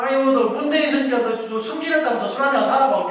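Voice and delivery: hammed-up friendly jab, 아이고 thrown out; affectionate teasing friend. Friend ribbing a newly promoted pal for drinks, playful mock scolding. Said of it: "이렇게 합니다".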